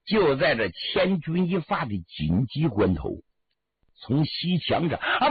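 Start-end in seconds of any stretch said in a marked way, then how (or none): none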